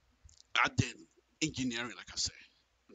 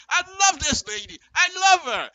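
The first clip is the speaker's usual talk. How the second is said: much higher and louder, gleeful; gleeful exclaim; 13 semitones higher, 9 dB louder than usual. Real, not acted. Praising the smart politician, high gleeful gush. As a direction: gush with ironic delight. Ironic delight.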